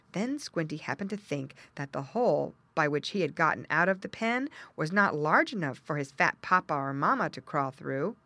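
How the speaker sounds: plain reading voice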